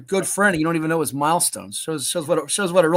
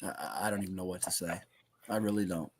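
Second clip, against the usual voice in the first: muttering; 10 semitones lower, 13 dB quieter than usual. Real, not acted. Exasperated aside.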